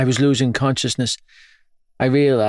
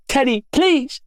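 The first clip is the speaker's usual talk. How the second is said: pleading mother. Acted.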